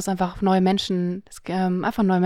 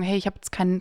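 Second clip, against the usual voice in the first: ordinary talk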